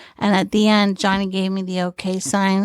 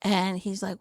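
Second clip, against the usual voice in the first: usual speaking voice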